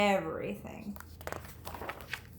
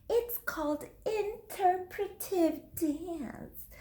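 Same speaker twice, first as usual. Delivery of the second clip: put-on grand little girl; 8 semitones higher, 5 dB quieter, slower than usual. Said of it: "said Lily".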